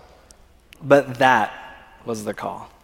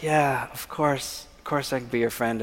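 forced agreement; 8 dB quieter than usual. Retold or imitated. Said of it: "I'd like to say that I willfully was like".

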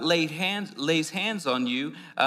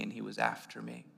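usual speaking voice

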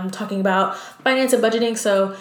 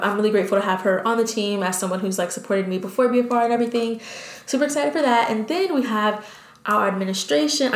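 rushing through excitedly; fast, eager rush of words; talking fast. Real, not acted. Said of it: "I'm sorry, I'm talking so fast".

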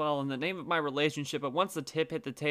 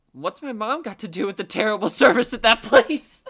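laughing confused; 7 semitones higher, 11 dB louder than usual. Retold or imitated. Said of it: "Even more confused than me".

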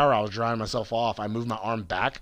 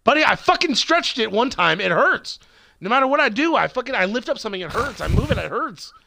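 higher, louder frustrated rant at the buddy; venting about his sore shoulder, raised and exasperated; 11 semitones higher, 5 dB louder than usual. Real, not acted. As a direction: vent that nothing helps, voice raised. Ranting about shoulder pain.

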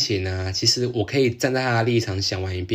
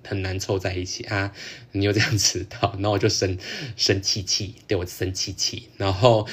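usual speaking voice

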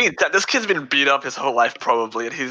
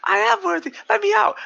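put-on much higher, squeaky panicked kid. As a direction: squeal it like a scared boy. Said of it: "he's like".

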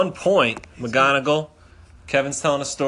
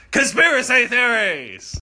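hyped announcement; 13 semitones higher than usual. Acted. Hyping a show segment.

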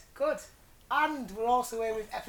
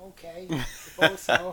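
reluctant; 6 dB louder, slower than usual. Retold or imitated. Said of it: "and you were like".

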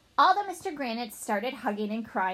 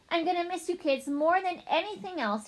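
high, mildly emotional farewell; a touch of tearfulness, pitched up; understated sentimental goodbye to the class; tearful goodbye; 8 semitones higher than usual. Acted. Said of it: "tears in his eyes".

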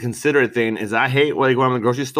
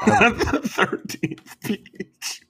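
laughing; 7 semitones higher, much slower than usual. Real, not acted. Laughing repeat of peach line.

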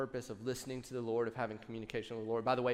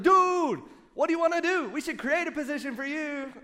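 very high, loud, excited; excited friend; 14 semitones higher, 8 dB louder than usual. Retold or imitated. Friend Jason thrilled, offering him a job. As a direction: burst out like a thrilled buddy. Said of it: "He was like".

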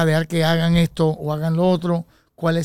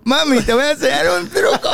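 high, laughing mock tipsy come-on; tipsy show-off; 10 semitones higher than usual. Tipsy husband offers his wife a card trick, hammed-up and laughing. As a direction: imitate a tipsy man offering a trick, much higher. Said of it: "Cuando estás así con tu trago".

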